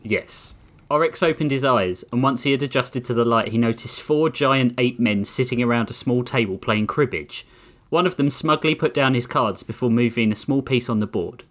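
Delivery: ordinary talk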